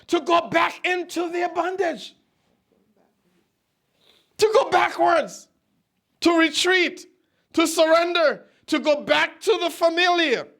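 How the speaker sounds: high, insistent preacher's cadence; impassioned preaching. Real, not acted. Preacher lists what the people would return to, impassioned and high. Preaches in a raised, driving list.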